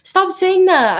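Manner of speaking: loud, high, playful protest. Real, not acted. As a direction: shout it back in jest. Protesting a teasing question.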